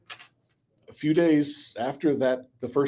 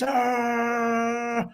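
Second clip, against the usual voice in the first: coach scream; 10 semitones higher than usual. Acted. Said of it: "he screams".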